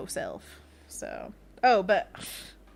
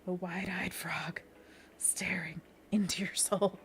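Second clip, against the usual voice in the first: eerie whisper; 4 dB quieter than usual. Pretend. Dramatic whisper about the drawing.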